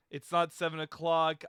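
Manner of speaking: ordinary delivery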